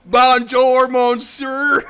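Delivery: loud, high hammed-up Aussie French; mock French greeting; Duke's accent in French. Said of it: "Doesn't lose his accent".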